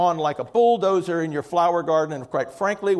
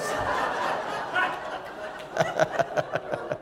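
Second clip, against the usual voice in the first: breathy laugh; 12 semitones higher, 5 dB quieter than usual. Real, not acted. Laughs at own joke.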